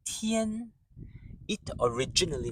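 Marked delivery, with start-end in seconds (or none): 0.0-0.7 Tian high and steady; first tone. Shown as a demonstration. Teacher's language note on Tian, high steady tone. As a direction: keep Tian high and level. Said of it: "high and steady".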